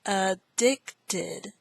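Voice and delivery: "Addicted" parted as a-dic-ted; syllable by syllable. Shown as a demonstration. Teacher introduces word six, "Addicted" in separate syllables. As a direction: say "Addicted" with gaps between syllables.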